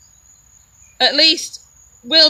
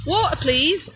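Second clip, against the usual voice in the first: Derbyshire "Water, please", higher pitched, crisp T in Water; Derbyshire accent; 4 semitones higher than usual. Retold or imitated. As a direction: retell a polite Derbyshire request for Water. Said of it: "she still didn't get it".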